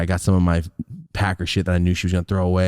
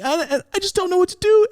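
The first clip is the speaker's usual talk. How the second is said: much higher, stammering mock sob; fake sobbing. Mom to grandma on the phone, fake sobbing. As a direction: wail helplessly, over the top. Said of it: "she's fake sobbing".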